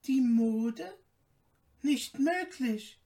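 stunned, she can't believe her friend betrayed her; disbelieving cry. Acted. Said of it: "rief Märchen".

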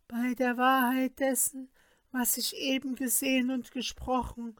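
high, solemn incantation voice; sorceress casting a spell. A narrator's reading.

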